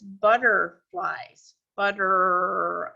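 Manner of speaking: "butterflies" drawn out slowly; slow sounding out. Shown as a demonstration. Teacher stretching "butterflies" slowly for the class. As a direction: stretch "butterflies" to show the R inside. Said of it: "But look at this".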